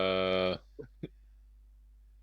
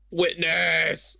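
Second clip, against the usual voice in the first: loud accusing shout; 7 semitones higher, 4 dB louder than usual. Acted. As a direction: point and yell it out. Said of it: "Like that".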